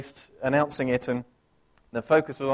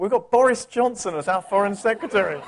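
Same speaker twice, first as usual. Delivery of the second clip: laughing; 5 semitones higher, 4 dB louder than usual. Real, not acted. Wry aside on new foreign secretary.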